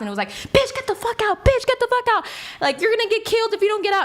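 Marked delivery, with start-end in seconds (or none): 0.3-1.5 high, strained yell at the driver; robber's yell. Retold or imitated. Gunman ordering her out of the car, yelling high. 1.5-4.0 high, louder and faster threatening yell; louder robber's yell. Retold or imitated. Gunman threatening to kill her unless she gets out, screaming.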